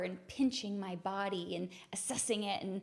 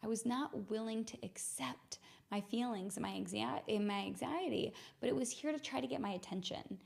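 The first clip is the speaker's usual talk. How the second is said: ordinary talk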